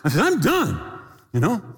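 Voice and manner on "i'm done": high-pitched emphatic quitting; exasperated declaration. Retold or imitated. Pilot swears off flying to the owner, high exasperation. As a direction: throw it out high and final. Acted refusal to fly again.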